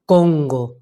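"Congo" said low and deep in the throat; back resonance. Shown as a demonstration. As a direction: place "Congo" far back on the palate, deep. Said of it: "mi voz se pone muy grave". ("Congo" grave, resonando atrás)

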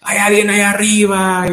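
storyteller's stranded-climber yell, pitched high; acted shout. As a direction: call up the mountain for anyone there. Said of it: "empieza a gritar".

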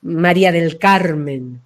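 slow, low, weighty naming; big grand name. Shown as a demonstration. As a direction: let each name take up its full space. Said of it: "un nombre grande".

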